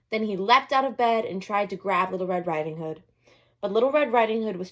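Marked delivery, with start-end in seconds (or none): none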